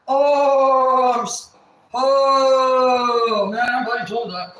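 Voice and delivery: mock outburst from the brother; mock shocked yell. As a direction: imitate the brother blurting it out in shock.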